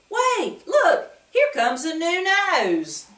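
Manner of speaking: high, loud excited cry; excited wemmick. A narrator's reading. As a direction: point it out with delight, high. Wemmick spots a new nose.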